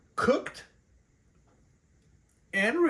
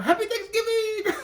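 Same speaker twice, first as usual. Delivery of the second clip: high, loud, laughing holiday cheer; excited; 14 semitones higher, 4 dB louder than usual. Acted. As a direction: burst out with a laughing high greeting. Cheerful holiday greeting to viewers.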